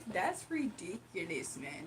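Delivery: put-on "That's ridiculous" said slowly and softly in the friend's accent; a careful go at the friend's accent; copying his accent. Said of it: "pick up the accent so I can talk like it".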